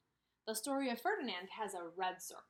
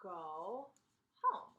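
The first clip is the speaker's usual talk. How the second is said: slow dictation while writing; dictating; much slower than usual. Shown as a demonstration. Teacher writes on the Venn diagram for kids, slow dictation. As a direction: stretch each word out as you write it. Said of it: "dictating slowly while writing".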